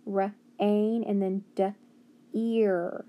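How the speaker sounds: "R-ain", "d-", "ear" in separate pieces; sounding out. Shown as a demonstration. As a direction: break off each first sound, stretch "ain" and "ear". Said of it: "making that A sound too".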